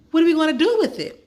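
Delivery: can't wait to know; fast excited child question; eager question. Acted. Excited girl asking fast.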